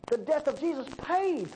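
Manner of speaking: usual speaking voice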